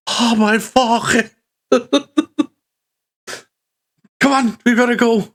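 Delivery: npc frantic to get out of the cold; panicked npc. Acted. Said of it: "And he's".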